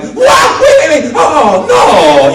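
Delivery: high, loud frantic yelps; panicked gaming yell. Retold or imitated. Playing Mario with four people, a loud high panicked yell. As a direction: shriek like the game just went wrong. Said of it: "do the voices uncontrollably".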